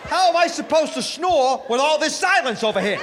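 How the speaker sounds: high, loud put-upon yell; wife shouting back at husband; mock indignant shout. Wife's retort, shouted.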